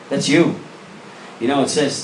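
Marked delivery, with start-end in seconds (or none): none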